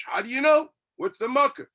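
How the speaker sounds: loud, high challenge; challenging. Acted. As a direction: fire the question back. Voicing the challenge.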